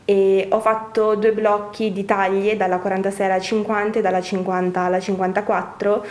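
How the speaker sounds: ordinary talk, nothing marked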